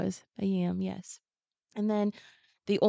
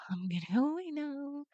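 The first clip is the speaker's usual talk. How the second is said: nervous retreat; 8 semitones higher, 5 dB quieter than usual. Retold or imitated. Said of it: "and be like".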